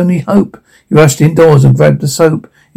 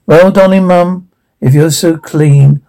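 ordinary delivery

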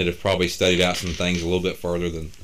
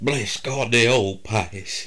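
mock preacher; 4 semitones higher, much slower than usual. Mock fire-and-brimstone preacher.

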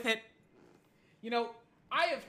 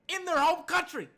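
loud, high-pitched boast; loud gloating; 8 semitones higher, 5 dB louder than usual. Real, not acted. Sports host gloats over beating France at home, gloating. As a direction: crow it triumphantly, louder. Gloating about US win abroad.

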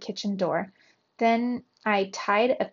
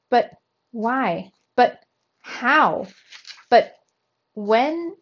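raised, halting burst of questions; flustered stammer; 3 semitones higher, 5 dB louder, slower than usual. A narrator's reading. Uncle finding his nephew stowed away, stammering in surprise. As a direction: stammer in astonishment, starting over each time. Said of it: "I stammered".

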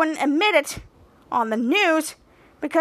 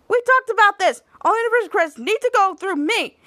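scolding; 6 semitones higher, 3 dB louder than usual. Retold or imitated. Captain Man scolds.

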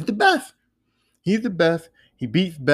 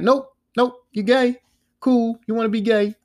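higher-pitched, curt brush-off in imitation; mock dismissive; 6 semitones higher than usual. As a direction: act out a woman waving someone off. Acted dismissal of a suitor.

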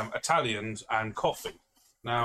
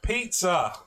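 louder, every syllable pushed out; loud slow tourist English; 7 semitones higher, 4 dB louder than usual. Retold or imitated. Tourist shouting a single word at the waiter, loudly. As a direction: bark it like a tourist who thinks volume translates. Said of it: "spoke really loudly in English".

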